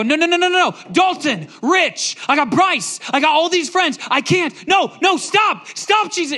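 high, loud desperate pleading, reliving the dream; panicked screaming. Retold or imitated. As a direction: beg Jesus to stop, terrified for friends. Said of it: "And I'm screaming".

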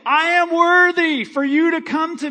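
high, loud mock arrogance; mock proud boast. Pastor mocking a proud believer before God, hammed-up boast. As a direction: puff up like someone full of himself. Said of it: "no, you're gonna say".

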